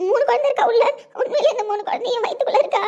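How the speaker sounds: laughing through happy tears. Acted. Overjoyed mother hears of triplets.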